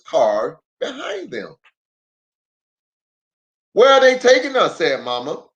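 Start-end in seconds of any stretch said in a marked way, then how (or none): 3.7-4.8 high, louder, fast worried question; anxious mother bear asks; quick fretful question; Mama's worry. Acted. Mama worried while towed.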